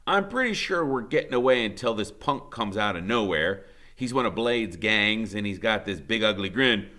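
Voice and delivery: usual speaking voice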